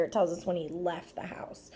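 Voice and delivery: soft whispered aside to the class; hushed whisper while pointing at the problem. Real, not acted. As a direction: whisper it as if sharing a secret. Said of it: "I'm also gonna emphasize this word here".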